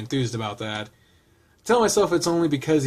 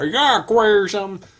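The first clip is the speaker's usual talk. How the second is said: lightly put-on southern accent, higher and louder; 7 semitones higher, 5 dB louder than usual. Reader redoing the line as intended, southern accent. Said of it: "Say that with a southern accent".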